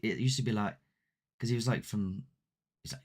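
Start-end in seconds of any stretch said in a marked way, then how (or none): none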